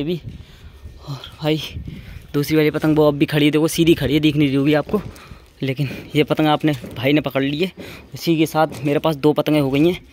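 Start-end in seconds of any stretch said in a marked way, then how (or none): none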